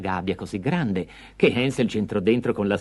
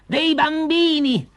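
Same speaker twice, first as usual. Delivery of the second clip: triumphant witch with high, swooping pitch; gleeful witch; 7 semitones higher than usual. Acted. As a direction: gloat over the trap, shrieking at the end. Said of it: "la vecchia strillò di gioia". (strega che esulta trionfante)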